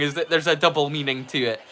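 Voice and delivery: usual speaking voice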